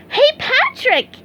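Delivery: high, slow, ringing call. A narrator's reading. SpongeBob calling at Patrick's house, high call. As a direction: call across to a friend. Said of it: "he called".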